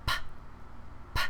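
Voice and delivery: "P" a puff of air, no voice; voiceless P. Shown as a demonstration. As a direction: pop "P" with breath only. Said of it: "P is your voiceless consonant".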